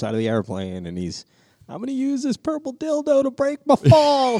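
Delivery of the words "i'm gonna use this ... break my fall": very high-pitched mock falling cry; hammed-up movie quote. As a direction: imitate a man calling out mid-fall. Acts out falling movie character's line.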